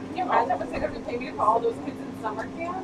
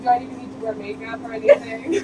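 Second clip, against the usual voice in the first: incredulous self-quote; 12 semitones higher, 7 dB louder than usual. Retold or imitated. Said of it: "I was like".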